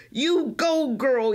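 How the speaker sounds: cheering yell from the court; a light, cheering yell. A narrator's reading. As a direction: call out to a teammate, cheering. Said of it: "yells Imani".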